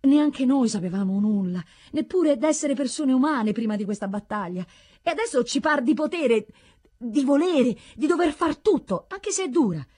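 high-pitched, mounting conviction; fervent villagers, rising in pitch; earnest fervour. A narrator's reading. Villagers earnestly appeal to hero.

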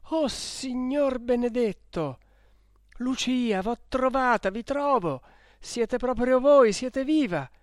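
high, loud cry of amazement; astonished cry. A narrator's reading. Renzo finding Lucia alive, crying out in amazement. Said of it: "guarda più attenta e grida".

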